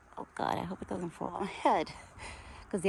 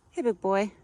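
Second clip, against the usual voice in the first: high, louder pet greeting; cooing greeting; 4 semitones higher, 5 dB louder than usual. Real, not acted. Owner greeting her big pet, cooing. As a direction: call sweetly to the animal.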